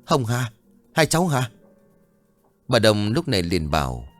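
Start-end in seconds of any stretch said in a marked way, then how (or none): 0.0-1.5 mild narrated shout, pitch raised; understated cry of recognition; loud cry. A narrator's reading. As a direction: call out higher without real volume. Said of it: "Ông Tùng liền hét lớn".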